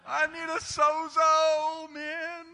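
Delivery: high, loud, slow comic wail; milk the punchline; comic exclaim. Acted. Pastor jokes to the congregation, high and loud.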